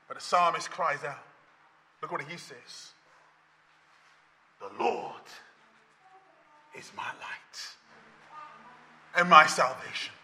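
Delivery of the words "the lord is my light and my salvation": hushed scripture. Acted. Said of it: "psalm read in a hush".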